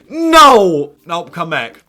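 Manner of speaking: high, loud cry of protest; real dismayed scream; anguished shout. Real, not acted. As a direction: yell no as your star walks away. His star player is retiring.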